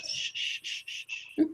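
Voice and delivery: repeated voiceless shushes; shushing. Real, not acted. Speaker hushes a friend who interrupts, shushing. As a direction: hush someone with a string of shushes. Shushing someone talking over him.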